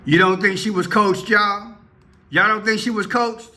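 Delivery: high-pitched, disbelieving question; incredulous challenge. Real, not acted. Commentator asking viewers if child was coached, incredulous. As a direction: challenge the listeners, pitched well up.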